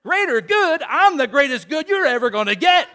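high, loud sassy retort; indignant wife. Retold or imitated. Wife fires back at Frozone, high and loud. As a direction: put him in his place. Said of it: "what she says".

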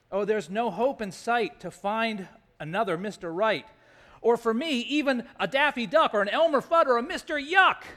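hammed-up comic lament; Naomi. Widow despairing of a husband, rising comic complaint. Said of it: "This is Naomi speaking".

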